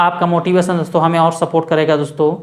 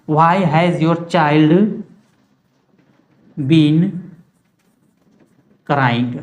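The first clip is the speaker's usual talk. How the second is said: slow dictation; 2 semitones lower, much slower than usual. Shown as a demonstration. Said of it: "आपका बच्चा एक घंटे से क्यों चिल्ला रहा है?".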